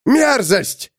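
high roar of disgust; sudden high bellow. A narrator's reading. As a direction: bellow the word out. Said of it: "заревел Арпу снисходительный".

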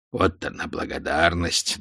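inner thought. A narrator's reading. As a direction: think it to yourself, low. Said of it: "думал он". (про себя, ниже и тише)

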